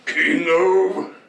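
loud roared move name; roaring grunting brute. Acted. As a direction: roar it like a brute. Super move callout.

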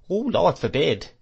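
Peggotty cries out, startled; cried out. A narrator's reading. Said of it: "cried Peggotty".

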